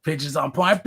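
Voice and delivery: ordinary delivery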